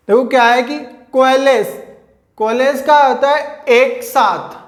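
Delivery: ordinary delivery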